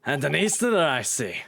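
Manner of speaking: Scottish governor sizing someone up; Scottish accent. Acted. Governor noting that the visitor is an Easterner, Scottish accent. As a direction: question them in a Scots brogue. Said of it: "Irish slash Scottish".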